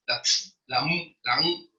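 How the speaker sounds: isolated letter sounds ts and mu, slow and clipped apart; phoneme sounds. Shown as a demonstration. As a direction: sound out "ts" then "mu" on their own, slowly. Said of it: "Saber que este es".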